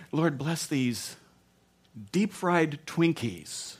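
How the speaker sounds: slow, drawn-out mock grace; mock prayer. Diner blessing fair food before God, slow mock prayer. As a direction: pray it slowly, savouring each word.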